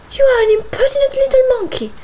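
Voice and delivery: very high, loud astonished cry; shocked, exclaim it; surprised exclamation. A narrator's reading. Missus Linton exclaiming in surprise at Isabella. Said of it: "exclaimed Missus Linton in surprise".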